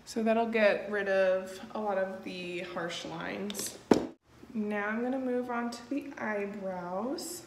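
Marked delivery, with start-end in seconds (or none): none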